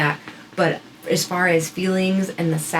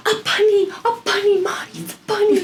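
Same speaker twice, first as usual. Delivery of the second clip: much higher, squealing "A bunny, a bunny"; excited child; 14 semitones higher than usual. Retold or imitated. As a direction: squeal like a thrilled toddler at the window. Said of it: "She's, like, so excited".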